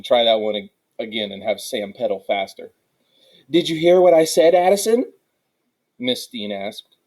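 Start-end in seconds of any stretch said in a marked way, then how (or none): 3.5-5.1 higher, louder, rising question; teacher calling out pupil. A narrator's reading. Teacher to Addison who drifted off, raised questioning voice. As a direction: check sharply on a daydreaming pupil.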